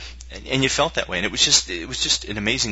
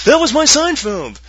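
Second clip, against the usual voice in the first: very high, loud, drawn-out impression; Seinfeld; 9 dB louder, slower than usual. Host defending a misheard impression, high loud comic voice. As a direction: stretch it out in a shrieky comic pitch. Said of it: "was supposed to be Seinfeld".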